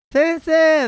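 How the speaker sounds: high, loud cry of "sensei!"; mock shout at the teacher; imitating a loud call. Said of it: "めちゃくちゃ大声で".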